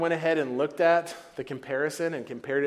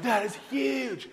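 higher, slow, drawn-out "huge"; wide-eyed amazement; 10 semitones higher, slower than usual. Retold or imitated. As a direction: marvel at the size, playfully. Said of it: "they're like".

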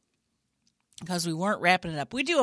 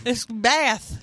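louder British "Bath"; put-on British accent; 4 semitones higher, 5 dB louder than usual. Improviser correcting co-host, put-on British accent. Repeating the accented place name.

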